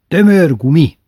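firm corrective retort; little brother correcting with pride. Retold or imitated. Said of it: "javított ki bátyó".

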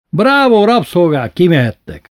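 high, loud cheer easing into an order; lordly shouted praise; shouted dismissal. A narrator's reading. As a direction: call out grandly to servants. Master dismisses his slaves loudly.

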